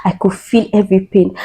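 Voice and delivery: ordinary delivery, nothing marked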